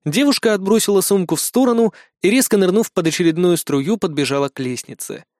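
ordinary delivery, nothing marked

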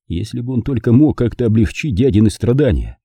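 ordinary delivery, nothing marked

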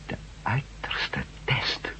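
slow, breathless whisper of disbelief; hushed horrified echo, drawn out slowly; stunned whisper. Acted. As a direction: whisper it back, aghast. Stunned at the ultimate test.

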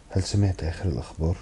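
ordinary delivery, nothing marked

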